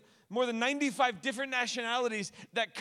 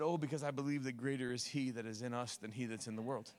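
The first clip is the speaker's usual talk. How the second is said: calm, sincere answer; 9 semitones lower, 7 dB quieter than usual. Retold or imitated.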